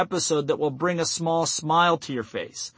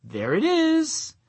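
high, drawn-out singsong delight; playful "found it!" lilt, pitched way up; smiling realization; 10 semitones higher, slower than usual. Shown as a demonstration. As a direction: say it with a big smile, stretched out. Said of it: "smile, and say".

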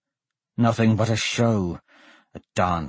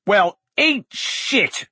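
defiant shout; 10 semitones higher, much slower than usual. A narrator's reading. Condemned Blaze answers his death sentence, shouting defiantly. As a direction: throw it back defiantly, slow and high. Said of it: "Blaze shouted".

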